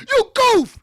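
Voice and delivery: high, loud mocking shout; goof shout. Real, not acted. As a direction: yell the insult right in her face. Insult shouted at Jane Fonda.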